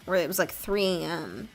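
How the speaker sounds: ordinary delivery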